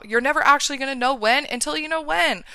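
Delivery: ordinary delivery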